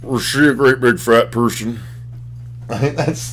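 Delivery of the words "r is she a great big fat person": garbled creepy killer impression; movie villain impression, words slurred together; Buffalo Bill. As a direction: do a serial killer's odd slurred voice. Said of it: "that's pretty much Buffalo Bill right there".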